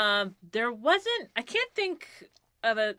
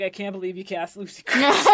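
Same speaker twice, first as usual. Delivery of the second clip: loud, laughing mock complaint; mock outrage; 8 dB louder than usual. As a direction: play the sore loser for laughs. Mocks a resentment she'd never voice.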